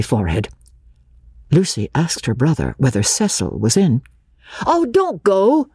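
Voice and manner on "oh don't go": high, slow pleading cry of Oh, don't go. Acted. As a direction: call out to keep her from leaving. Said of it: "he cried".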